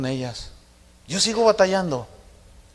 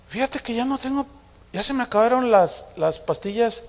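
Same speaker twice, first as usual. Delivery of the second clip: imitated daughter; 6 semitones higher, 4 dB quieter than usual.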